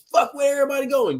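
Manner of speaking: high, rushed mock confusion; mock bewilderment. Player as everybody starts running, mock bewildered.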